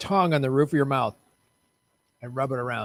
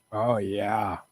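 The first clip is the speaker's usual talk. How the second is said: mock sultry; 4 semitones lower than usual. Mock sexy moan.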